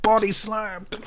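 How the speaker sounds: high, held-back play-wrestler shout; mock wrestling call. Joking wrestling moves with daughter.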